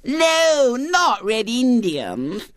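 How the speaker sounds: very high, drawn-out lisping refusal; piping small-girl objection; dismayed little girl. A narrator's reading. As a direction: wail a childish protest. Little girl rejects his game idea.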